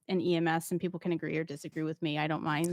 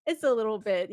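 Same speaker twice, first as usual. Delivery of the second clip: normal talking voice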